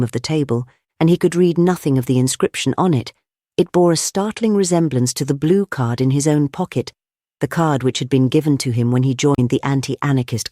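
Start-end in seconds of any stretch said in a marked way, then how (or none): none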